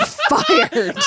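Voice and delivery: high, laughing mock dismissal. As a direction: laugh through a joking "you're fired". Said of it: "And I was like".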